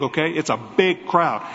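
ordinary talk

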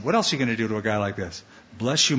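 ordinary talk, nothing marked

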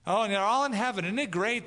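high, loud, fast hammed-up cheer; mock gushing. Mimicking unbelievers at a funeral, mock gushing cheer. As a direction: gush like a cheerful mourner. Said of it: "at a funeral talks about heaven".

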